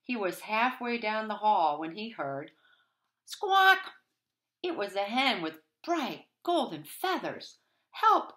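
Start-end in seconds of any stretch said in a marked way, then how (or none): 3.2-3.9 very high loud squawk; hen squawk. A narrator's reading. The hen cries out, high squawk. Hen's cry. 7.9-8.3 very high, loud hen cry; clucking hen. A narrator's reading. The hen calls Jack for help, clucking. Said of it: "she clucked".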